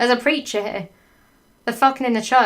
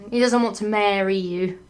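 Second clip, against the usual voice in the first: ordinary delivery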